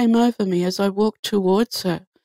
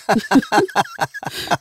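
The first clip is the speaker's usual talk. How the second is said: amused laugh. Real, not acted. Laughing at own joke.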